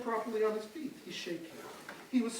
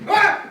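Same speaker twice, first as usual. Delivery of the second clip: sudden high startled cry; startled gasp; 8 semitones higher, 16 dB louder than usual. Acted. Storyteller yelps as the frog appears, startled. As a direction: yelp like you just spotted a frog.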